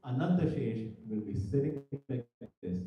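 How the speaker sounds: ordinary delivery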